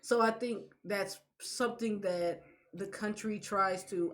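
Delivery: ordinary talk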